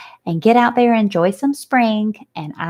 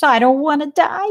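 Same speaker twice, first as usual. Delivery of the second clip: crying; 7 semitones higher than usual. Acted. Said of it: "burst into tears".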